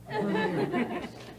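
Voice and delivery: a sudden high laugh at his own joke; amused laugh after the punchline; high burst of laughter. Real, not acted.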